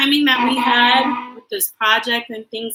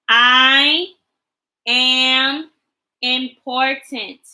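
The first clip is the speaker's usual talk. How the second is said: slow, clear leading of the sentence; choral reading; 4 dB louder, much slower than usual. Shown as a demonstration. Teacher leading the class in a slow choral affirmation. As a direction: read it slowly for the kids to join in. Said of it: "let's read our sentence together".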